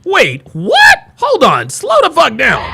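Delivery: loud, startled and high; shocked outburst. Real, not acted. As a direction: blurt it out in disbelief. Streamer shocked by machete claim.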